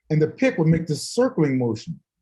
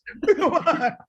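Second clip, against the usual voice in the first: helpless high laugh; high burst of laughter; 14 semitones higher than usual. Real, not acted. Laughter alone.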